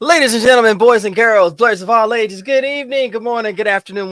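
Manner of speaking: loud, high showman opening; loud, high live show host greeting; host announcement. Real, not acted. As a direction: boom it out like an emcee welcoming the crowd.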